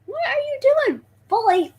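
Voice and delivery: real high-voiced fussing at a pet; high-pitched pet scolding. Real, not acted. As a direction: pitch it way up at the misbehaving snake. Scolding a straying pet snake.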